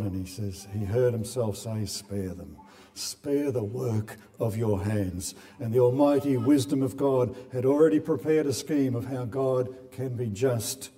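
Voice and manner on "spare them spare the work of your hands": merciful plea. Retold or imitated. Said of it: "mercy cried out in the heart of God".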